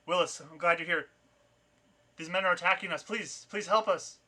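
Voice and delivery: urgent cry for help, acted; frightened plea. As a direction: plead with a rescuer, rising toward the end. Woman begs rescuer for help.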